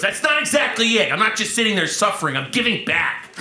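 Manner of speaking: put-out protest, starting high; Marc Maron. Retold or imitated. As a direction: imitate a comic defending himself. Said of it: "And he goes".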